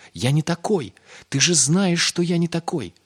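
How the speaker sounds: high, loud pleading denial; shouted protest. A narrator's reading. Pip tells Yosl he is different, shouting. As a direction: cry it out in a child's high voice. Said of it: "крикнул Пип".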